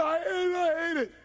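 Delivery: high, loud mock crying; hammed-up anguished cry; hateful wail. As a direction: wail it in imitated misery. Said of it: "hating it, right?".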